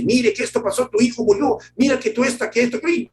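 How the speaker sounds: a messenger bursting in with bad news, high and urgent. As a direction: blurt out the terrible news. Acted bearer of bad news.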